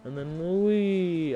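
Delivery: very slow, stretched-out whee, rising in pitch; gleeful whee. Real, not acted. Player takes a jump in game, gleeful. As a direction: draw it out as you go airborne.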